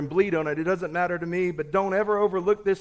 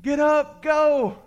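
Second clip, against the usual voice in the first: loud, high acted shouts; yelling; 10 semitones higher, 7 dB louder, slower than usual. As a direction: yell urgently to riders at a distance. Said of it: "at a distance are yelling".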